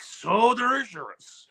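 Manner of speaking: slow, high mock Japanese accent, stretched out; hammed-up Japanese accent for a beer toast; a playful put-on Japanese accent, drawn out slowly. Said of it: "You have to introduce it in a Japanese accent".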